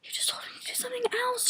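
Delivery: fast, hushed protest under the breath; high, barely voiced quick whisper; whispered retort. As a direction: whisper an exasperated comeback.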